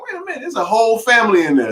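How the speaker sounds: the sudden wait-what of spotting a family; startled realization. Retold or imitated. To himself, finding a family in the car: startled. Sees kids in rental backseat.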